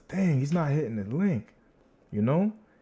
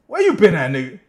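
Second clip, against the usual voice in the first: higher and louder greeting; excited shout-out; 4 semitones higher, 7 dB louder than usual. Real, not acted. Greeting a viewer arriving.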